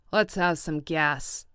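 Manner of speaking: rough cry. A narrator's reading. Tom to Wilson at the garage, rough loud order. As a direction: bark it slowly at the attendant. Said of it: "cried Tom roughly".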